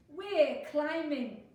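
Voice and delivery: loud, high, stretched-out call; gleeful shouting. A narrator's reading. Friends up a tree to Fox, slow loud shout. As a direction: call down from the treetop. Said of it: "they shouted".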